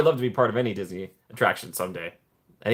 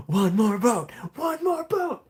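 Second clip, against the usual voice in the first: climbing into a much higher put-on voice; each repeat higher and sillier; silly high voice; 12 semitones higher, slower than usual. Shown as a demonstration. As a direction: repeat the phrase in a high cartoonish voice.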